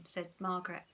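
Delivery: ordinary delivery, nothing marked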